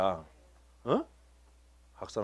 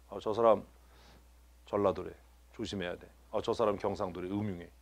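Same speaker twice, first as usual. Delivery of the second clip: low, soft imitation of gossips; hushed gossip; 7 semitones lower, 9 dB quieter than usual. Church members gossiping about someone's home province, low and hushed. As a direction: pass it on under your breath, suspicious.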